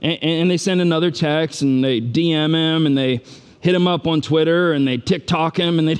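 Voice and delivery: ordinary talk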